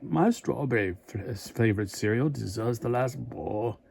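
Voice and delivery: slow second try at a mock monster accent; retrying Frankenberry's comic line in a put-on monster accent; attempted Frankenberry accent. A light, slow stab at a monster accent. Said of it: "I can't do his accent".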